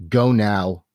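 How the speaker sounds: normal talking voice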